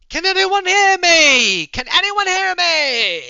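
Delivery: long, high, drawn-out shout; yelling for help. Acted. Randy calling up from the elevator shaft, yelling. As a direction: holler slowly up an elevator shaft. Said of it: "is somehow is yelling from the elevator shaft".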